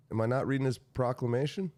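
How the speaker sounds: normal talking voice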